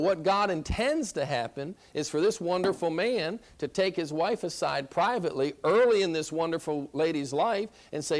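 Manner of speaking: normal talking voice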